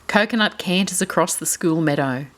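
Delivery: ordinary delivery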